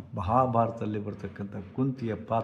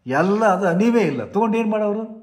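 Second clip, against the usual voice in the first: emphatic preaching; 8 semitones higher, 6 dB louder than usual. Acted. Preacher rousing despairing listeners.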